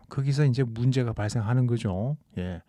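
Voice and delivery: normal talking voice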